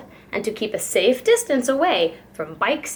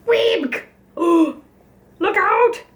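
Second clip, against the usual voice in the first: much higher, louder panicked squawk; alarmed squawk; 9 semitones higher, 4 dB louder, much slower than usual. A narrator's reading. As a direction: shriek a warning in a flustered dither. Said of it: "squawked Missus Mallard, all of a dither".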